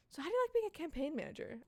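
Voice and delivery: high-pitched polite question; perky small talk. Acted. Kelly asking Megan about campaign work, perky.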